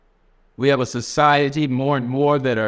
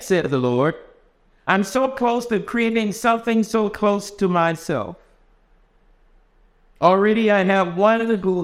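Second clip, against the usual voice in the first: lifted, expressive storytelling voice; story reading; 6 semitones higher than usual. A narrator's reading. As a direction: read it like a tender fable to the congregation. Reads story aloud in sermon.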